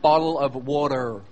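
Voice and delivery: low, mouthy "boddle of wadder"; American "bottle of water". Shown as a demonstration. Brit mocking his audience's lip movement, American "bottle of water". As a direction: work the lips round a hammed-up American "bottle of water". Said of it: "Notice the lip movement".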